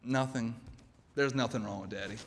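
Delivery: low, soft fatherly reassurance; reassuring father. Acted. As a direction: gently hush a child's worry, keeping it low. Father reassures his young daughter.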